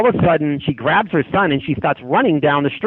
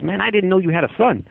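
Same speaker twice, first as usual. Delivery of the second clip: tough guy; 6 semitones higher than usual. Retold or imitated. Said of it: "a tough guy, you know".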